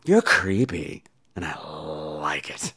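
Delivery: low, soft and slow tease; sultry whisper. Pretend. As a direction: breathe it, low and flirty.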